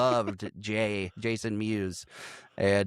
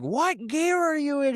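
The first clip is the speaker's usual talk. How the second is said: alarmed question. Retold or imitated. Quoting the alarmed passenger.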